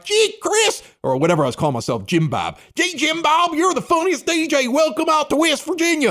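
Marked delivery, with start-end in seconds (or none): 0.0-0.8 very high mock-hillbilly caller; excited caller. Imitating a late-night caller to the DJ, high excited voice. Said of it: "they'd be all excited". 2.7-6.1 high-pitched mock-hillbilly radio fan; excited caller. West Virginia caller praising the late-night DJ, high excited imitation. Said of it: "they'd be all excited".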